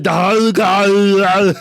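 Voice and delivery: mock Andre the Giant slurring "Dolly"; imitating Andre the Giant. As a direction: mumble "Dolly" like the giant who can't say the name. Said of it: "He would be like".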